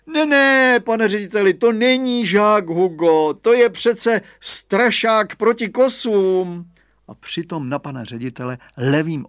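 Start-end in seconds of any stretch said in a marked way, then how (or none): 0.1-6.7 high, loud, slow protest; exclaimed. Acted. Father fooling the headmaster across the fence, loud high exclamation. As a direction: shout the cover story across the fence.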